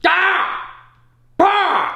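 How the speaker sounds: short, high, loud bursts of noise; sudden test shouts. Shown as a demonstration. Coach testing a deafened player's hearing, sudden shouts. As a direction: blurt out startling yells.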